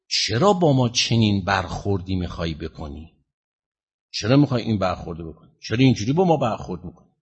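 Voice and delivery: ordinary talk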